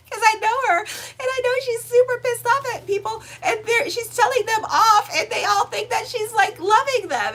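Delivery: high, loud and near laughter; helpless amusement. Real, not acted. Recalls it, barely containing laughter.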